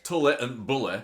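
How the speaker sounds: "Tully and Bully" in a northern English accent, short u vowels. Shown as a demonstration. Showing the rhyme works in a northern English accent. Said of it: "if you say it in a northern accent".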